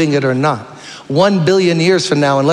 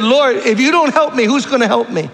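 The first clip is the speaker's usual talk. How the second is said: much higher, tearful pleading mother; weeping mother's prayer; 9 semitones higher than usual. Retold or imitated. Preacher imitating the mother weeping over her son, high and pleading. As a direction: cry out to God for your son. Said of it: "And she said".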